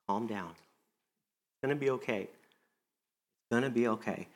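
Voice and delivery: soft and slow, calming; reassuring. Acted. Jesus calming a troubled Martha, gently reassuring. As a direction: soothe an anxious friend. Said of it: "He says".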